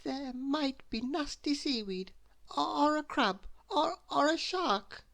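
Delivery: high, stammering timid voice; nervous squeak. A narrator's reading. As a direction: list the dangers in a frightened squeak. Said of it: "admitted Mister Jelly".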